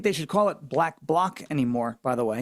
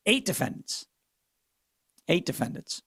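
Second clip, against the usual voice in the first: ordinary delivery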